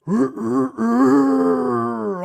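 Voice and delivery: loud, throaty imitated crow pitched under a normal rooster's; long, loud, ominous rooster call; deep rooster crow. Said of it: "dark rooster crow".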